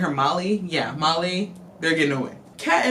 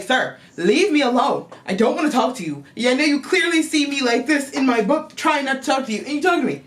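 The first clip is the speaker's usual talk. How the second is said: exasperated telling off; 8 semitones higher, 4 dB louder, faster than usual. Retold or imitated. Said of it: "then she's like".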